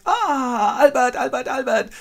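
high, loud squeal of delight; overjoyed greeting. Acted. Lotte greets returning Albert in a high, loud squeal. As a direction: gush the name with joy. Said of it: "and Lotte goes".

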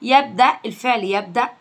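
ordinary delivery

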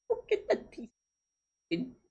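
put-on high, soft and slow, like comforting a baby; quiet baby-talk pity for a crying rat; soft pitying coo. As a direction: coo softly at the sad little drawing.

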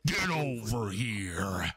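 low, slow gamer-villain growl; Scorpion growl. Retold or imitated. Answering chat, growls the Scorpion line low. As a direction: drag it out like Scorpion's spear call. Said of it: "insert scorpion quote here".

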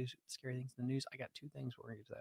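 slurred tipsy speech; slurring. Real, not acted. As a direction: run the words together, slurring. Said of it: "You're slurring your words".

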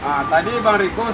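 ordinary delivery

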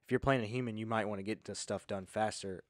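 ordinary delivery

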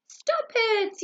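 high, loud annoyed shout; yelled. Acted. Sleepy badger yelling at singing sheep to stop. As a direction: snap at the noisy sheep. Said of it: "yelled Stripe".

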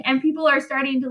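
usual speaking voice

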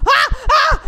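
shrill terrified shrieks, very high and loud; panicked screaming. Real, not acted. Gamer screaming in terror at a jumpscare.

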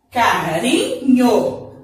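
Carinho drawn out slowly with a light tapped R; soft single R. Shown as a demonstration. Teacher showing children the tapped R, slowly. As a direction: gently tap the R in Carinho. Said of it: "olha como vai ficar diferente".

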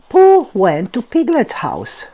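usual speaking voice

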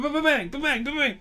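high loud playful gunfire sounds; gunshot noises. Pretend. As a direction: shout bang like a kid's toy gun. Mimicking gunfire again.

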